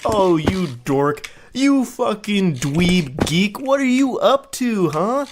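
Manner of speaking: hammed-up flirty ribbing, rising in pitch; mock playful insults. Mocking a texter's clumsy flirting, exaggerated playful insults. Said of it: "like, the playful insults kind of thing".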